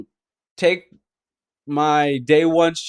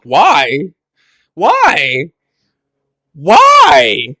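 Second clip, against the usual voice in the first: baffled, building protest; exasperated why; 4 dB louder, much slower than usual. Retold or imitated. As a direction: whine each why, building to the last. Retells baffled reaction to game.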